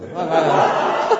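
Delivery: a high, loud burst of laughter; rising laugh. Real, not acted. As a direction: laugh out at the memory. Laughs recalling walking up front.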